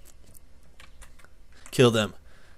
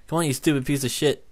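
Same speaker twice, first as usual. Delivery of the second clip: ordinary delivery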